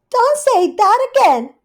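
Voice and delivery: very high, loud cross outburst; Rivy the cat snapping, very high and loud; exasperated snap. Acted. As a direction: lose your temper at the bird. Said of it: "losing her temper".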